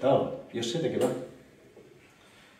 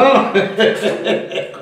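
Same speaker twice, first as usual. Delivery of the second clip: loud, high burst of laughter; knowing laugh; 8 semitones higher, 7 dB louder than usual. Acted. Joking with Manolo about potatoes, loud knowing laugh. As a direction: laugh hard at your own innuendo. Laughs at his own innuendo.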